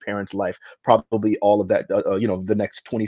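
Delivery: ordinary delivery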